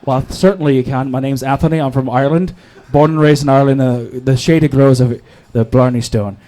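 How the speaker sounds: low, put-on Irish lilt; bad Irish accent. Shown as a demonstration. Asked to show his accent, mock Irish accent. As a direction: ham up a shaky-credibility Irish brogue. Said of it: "I bet you have an impeccable Irish accent".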